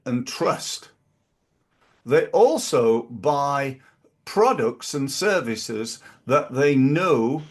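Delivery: normal talking voice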